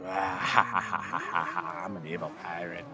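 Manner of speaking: slow, lightly voiced evil pirate. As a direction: drag it out like a mild evil pirate. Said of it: "my pirating voice".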